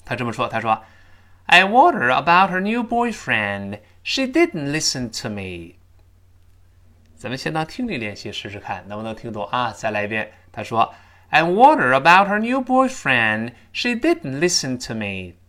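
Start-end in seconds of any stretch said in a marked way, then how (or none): 1.5-5.8 high, emphatic English model sentence; model dialogue reading. Shown as a demonstration. 11.3-15.4 high, emphatic English model sentence; model dialogue reading. Shown as a demonstration. Repeats the model dialogue line.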